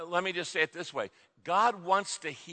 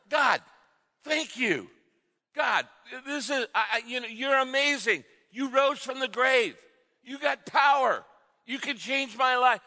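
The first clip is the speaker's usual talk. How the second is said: high, passionate praise; excited, raised-pitch exclamations to God; with enthusiasm; 7 semitones higher than usual. Shown as a demonstration. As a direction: pray it with real emotion and passion. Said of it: "Say it with a little bit of enthusiasm".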